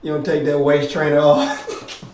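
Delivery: low teasing buddy voice; imitating CJ. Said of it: "C J like".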